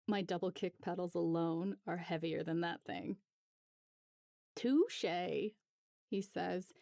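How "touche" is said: bad French accent. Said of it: "in the worst French accent I've ever heard".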